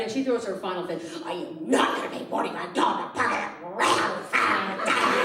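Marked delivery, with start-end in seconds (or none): 1.2-5.2 slow, grumbling mock fit; hammed-up grumpy refusal; crabby ex. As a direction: complain through the teeth. Ex throwing her final fit.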